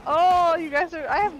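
high, loud flustered panic; panicking gamer. Real, not acted. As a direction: blurt it in a fluster. Panic at zombie.

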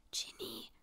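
hushed, voiceless whisper. A narrator's reading. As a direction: whisper the name into the phone. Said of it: "flüsterte Hermine".

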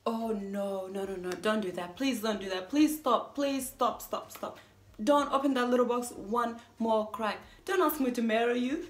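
frantic, insistent protest; urgent pleading. Acted. Speaker stopping a marriage proposal, urgent pleading. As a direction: cut them off in a panicked plea.

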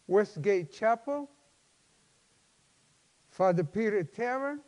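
usual speaking voice

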